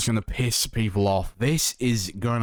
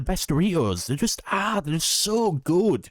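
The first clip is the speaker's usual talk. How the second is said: mock emotional; 7 semitones higher than usual. Real, not acted. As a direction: overcome with love for snacks. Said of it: "I'm getting, like, emotional about chips".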